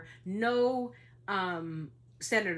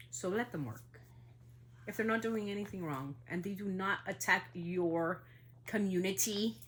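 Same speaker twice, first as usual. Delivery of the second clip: normal talking voice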